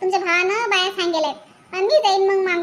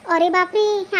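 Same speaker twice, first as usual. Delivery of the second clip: usual speaking voice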